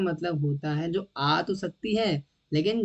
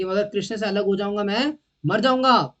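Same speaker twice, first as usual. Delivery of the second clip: building louder and higher, slowing at the end; acted desperate declaration; 4 semitones higher, 6 dB louder than usual. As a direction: declare it as a devotee who cannot bear separation. Voices a desperately attached devotee.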